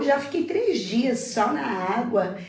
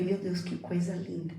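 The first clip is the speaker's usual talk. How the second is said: softer, hushed awe; softer moved wonder; awed and moved; 3 semitones lower, 8 dB quieter than usual. Retold or imitated. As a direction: barely breathe the words out. Moved to tears by story.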